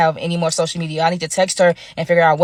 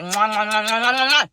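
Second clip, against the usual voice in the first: blown kisses; 2 semitones higher than usual. Real, not acted. Blowing kisses to her followers.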